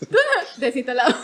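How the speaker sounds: loud, laughing brush-off; laughing dismissal. Retold or imitated. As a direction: toss it away with a laugh. Mock dismissal of the app. (despedida burlona y fuerte entre risas)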